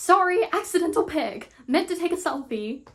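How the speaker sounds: high, loud apologetic blurt; acted texting daughter. Daughter excusing a stray photo to Mom, acted apology. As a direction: call it out high and quick to Mom. Reads Violet's panicky text message.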